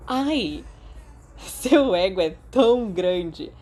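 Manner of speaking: slow, mischievous teasing with a loud push. Acted. Remus needling Sirius about his ego, slow and mischievous. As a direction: stretch the tease out, savoring it. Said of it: "Remus continuou maliciosamente".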